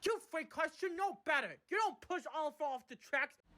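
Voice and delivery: high, sharp telling-off; angry scolding. Acted. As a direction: snap at the naughty freight cars. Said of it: "she snapped angrily".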